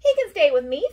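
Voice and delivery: high, very loud eager offer; thrilled. A narrator's reading. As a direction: chirp it out with delight. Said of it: "thrilled Bird".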